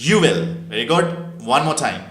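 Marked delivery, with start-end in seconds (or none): 0.1-0.4 "you will" blended into "you'll", loud; "you will" as one punched word, you'll; short form of "you will". Shown as a demonstration. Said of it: "আবার বলো".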